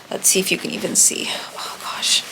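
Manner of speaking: soft unvoiced whisper. Real, not acted. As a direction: breathe the words, no voice. Showing the wound on camera.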